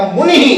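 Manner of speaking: मुनि said briskly as "munihi"; visarga echoing I. Shown as a demonstration. Teacher contrasting spelling with speech, saying "munihi". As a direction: end मुनि on a short "hi". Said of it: "बोला ऐसे जाएगा".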